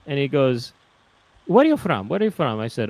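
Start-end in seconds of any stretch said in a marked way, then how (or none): 1.5-2.6 put-on eager Italian-accented question, higher; Italian seller. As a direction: ask it like a pushy street vendor. Italian street seller imitated.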